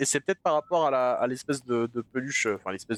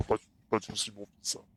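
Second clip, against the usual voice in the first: demonic whisper; 6 semitones lower, 6 dB quieter, faster than usual. Pretend. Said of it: "tu l'entends, c'est en train de vaper".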